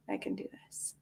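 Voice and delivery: breathless whisper of self-encouragement. Real, not acted. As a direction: whisper it to yourself to build courage. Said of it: "self-encouragement".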